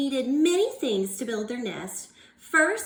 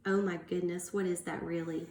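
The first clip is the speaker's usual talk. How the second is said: ordinary delivery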